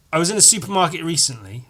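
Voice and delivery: ordinary talk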